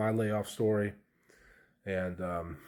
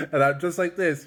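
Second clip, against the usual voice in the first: laughing; 8 semitones higher, 8 dB louder than usual. Real, not acted. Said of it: "I'm just kinda laughing".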